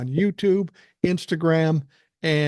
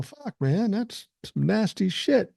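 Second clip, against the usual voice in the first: disgusted bystander; 4 semitones higher, slower than usual. Retold or imitated. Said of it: "you go".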